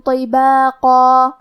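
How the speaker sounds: slow, drawn-out tajweed chant; Quran recitation. Shown as a demonstration. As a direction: recite each verse slowly and melodically. Teacher recites Quran verses. (دھیمی، کھینچی ہوئی تلاوت)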